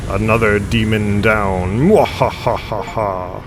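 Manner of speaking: slow villain gloat into cackle; mad scientist. Acted. Villain gloat after boss kill.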